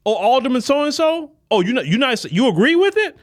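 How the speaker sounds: high, incredulous taunt; mock indignant challenge. Voters confronting an alderman, high incredulous taunt. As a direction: sneer at the alderman's choice.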